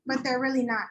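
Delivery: ordinary talk, nothing marked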